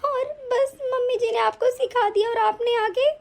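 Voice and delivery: sobbing between phrases; tearful pleading. Acted. Wife defending herself to her accusing husband, crying. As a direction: explain yourself through hurt sobs. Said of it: "अरे ये ज्यादा नाटक तो तुम अभी करोगी".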